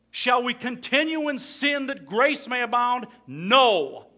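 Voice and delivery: loud, high sermon voice; full-force preaching. Acted. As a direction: thunder it at the church.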